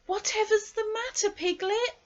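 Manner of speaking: put-on boy's voice. A narrator's reading. Christopher Robin getting up, asking Piglet, high boyish voice.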